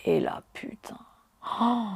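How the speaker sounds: breathless whispered curse, barely voiced; stunned whisper. Real, not acted. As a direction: let it slip out in a shocked whisper. Stunned reaction to his remark.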